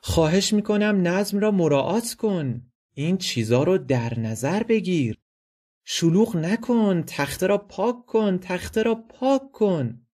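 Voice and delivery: high, agitated pleading for order; pleading principal. Acted. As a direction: beg the student to behave, high and urgent. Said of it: "و رو به محصل".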